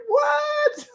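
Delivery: high-pitched, incredulous "what?"; shocked disbelief. Retold or imitated. Storyteller replaying his shock at himself, very high and incredulous. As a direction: yelp it in stunned disbelief.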